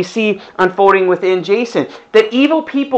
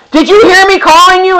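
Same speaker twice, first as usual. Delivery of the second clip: loud, higher, exasperated call; yelling parent; 10 semitones higher, 7 dB louder than usual. Acted. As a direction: shout at a kid who can't hear. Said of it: "you're yelling".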